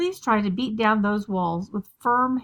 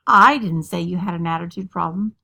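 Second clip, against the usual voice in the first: "I" hit hard and loud at the start; strong emphasis on the opening "I"; stress on I; 3 semitones lower, 5 dB louder than usual. Shown as a demonstration. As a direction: punch the word "I", let the rest fall away. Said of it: "just by emphasizing a different word".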